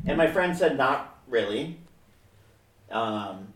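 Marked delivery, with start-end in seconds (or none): 0.8-1.8 low, soft retold refusal; mild, polite refusal. Friend answering the musician's request, mildly and without rudeness. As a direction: turn him down quietly without being rude. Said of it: "not like an asshole".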